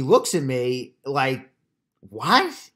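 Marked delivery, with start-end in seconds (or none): 2.0-2.7 high, loud, stunned "What?"; a startled, disbelieving question; surprised what. Retold or imitated. Teller turning to the customer, stunned and surprised.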